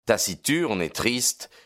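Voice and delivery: usual speaking voice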